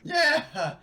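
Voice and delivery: a high, loud burst of agreement; excited yeah. Real, not acted. A player cheering a teammate's point, high and loud. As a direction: jump in loud and delighted.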